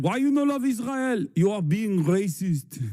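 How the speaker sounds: high, put-on mocking accent, slowed at the end. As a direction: ham up a broken-English caricature. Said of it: "That chatter literally said".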